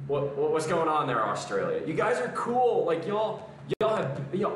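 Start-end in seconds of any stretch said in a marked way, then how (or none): none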